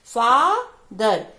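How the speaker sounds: "Father" with a long, loud फा and falling दर; syllables read separately. Shown as a demonstration. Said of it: "दोनों को अलग-अलग करके हमने पढ़ा".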